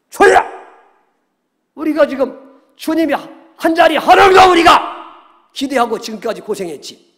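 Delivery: high, shouted remonstration with the master; acted-out shouting objection; loud protest. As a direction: cry out in protest, voice jumping up high. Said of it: "막 큰 소리로 항변했다는 얘기는".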